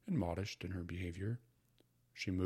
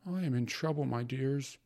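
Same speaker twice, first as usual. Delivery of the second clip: loud, higher worried mother; mother sighing; 6 semitones higher, 6 dB louder than usual. A narrator's reading. Mother tells children she's in trouble, worried. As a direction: fret aloud about trouble. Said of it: "she began, sighing".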